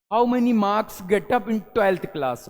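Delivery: how many marks said very slowly, marks as maks with no r; silent r, slow. Shown as a demonstration. Teacher slowly models the full question with marks as maks. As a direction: stretch each word and keep the r in marks silent. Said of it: "मार्क नहीं पढ़ेंगे".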